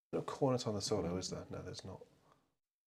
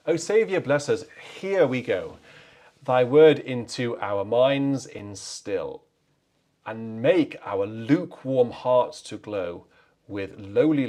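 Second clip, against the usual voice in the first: slow, louder, solemn Yorkshire-flavoured recital of hymn verses; 8 dB louder, slower than usual. Acted. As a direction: declaim the hymn lines slowly like a Yorkshire parishioner. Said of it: "reads the hymn text aloud".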